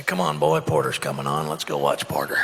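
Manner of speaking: deep, low dad voice calling his son; doing my old man. Retold or imitated. Dad calling his boy to the TV, low old-man voice. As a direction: drop way down into the old man's voice. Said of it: "when I do my old man".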